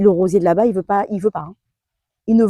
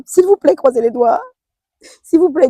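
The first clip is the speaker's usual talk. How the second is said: pleading; 6 semitones higher, 6 dB louder than usual. Real, not acted. Pleading viewers to cross fingers.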